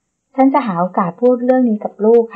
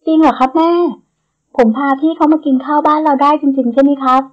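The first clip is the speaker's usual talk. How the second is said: excited; 5 semitones higher than usual. A narrator's reading. Nan asks his mother back, thrilled and excited. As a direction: ask back in delighted disbelief. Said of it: "ด้วยน้ำเสียงตื่นเต้น".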